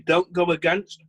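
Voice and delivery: talking with a mouth full; words muffled by food in the mouth. Real, not acted. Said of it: "sorry, talking in my mouth full".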